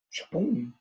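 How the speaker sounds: ordinary delivery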